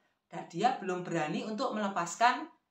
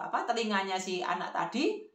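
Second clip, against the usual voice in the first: usual speaking voice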